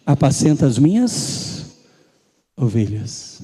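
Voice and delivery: gently entrust the flock; tender command. Acted. Jesus gently telling Peter to feed his sheep. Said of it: "Jesus vira pra ele e fala".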